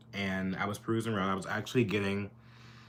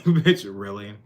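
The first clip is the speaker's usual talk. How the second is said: loud, sassy "bitch, really?"; sassy comeback; 10 dB louder, slower than usual. Retold or imitated. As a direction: throw the retort back loudly. Retold sassy comeback to cashier.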